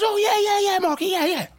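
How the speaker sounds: very high, shrieky mock-eager yeses; hammed-up squealed agreement, words tumbling out; imitating flustered hostess. Friend's partner agreeing to make coffee, hammed-up high flustered impression.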